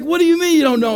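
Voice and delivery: high, loud incredulous scolding; imitated exasperated demand. Guard told the pastor is lost, exasperated shout. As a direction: shout it in disbelief down the phone.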